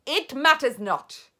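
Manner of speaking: loud commanding witch; dismissive command; witch shouting. Acted. Grand High Witch shouting at the witches, loud. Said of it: "shouted the Grand High Witch".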